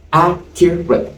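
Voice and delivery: slow down on Accurate so they hear three beats; slow syllable count. Shown as a demonstration. Teacher counting the syllables of Accurate slowly for the class. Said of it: "Accurate has how many syllables?".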